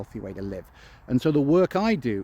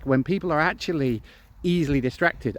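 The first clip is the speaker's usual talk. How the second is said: normal talking voice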